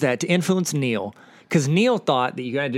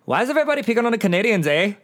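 quick, higher-pitched mock Canadian accent; bad Canadian accent; 7 semitones higher, much faster than usual. Podcaster imitating the Canadians complaining at dinner, bad Canadian accent. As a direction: put on an unconvincing Canadian accent. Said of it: "That's not a very good Canadian accent".

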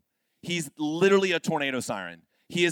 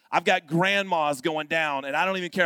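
ordinary delivery

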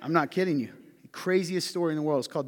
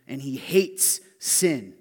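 "sin" slow and louder; stress on sin; 7 dB louder, much slower than usual. Acted. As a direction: land "sin" heavily and slowly. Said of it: "slow heavy emphasis".

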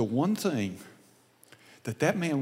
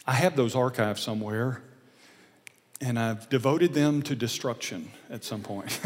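usual speaking voice

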